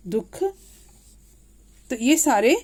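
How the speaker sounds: ordinary talk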